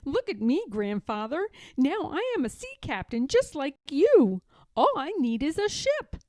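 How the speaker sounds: proud little sea captain; little bear proud. A narrator's reading. Little Bear admires himself.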